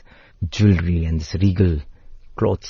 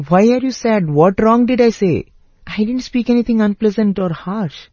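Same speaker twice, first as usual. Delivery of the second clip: much higher, gently baffled elder brother; puzzled Rama; 12 semitones higher than usual. Acted. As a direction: coax a hurt younger brother, kindly confused. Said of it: "And Rama says".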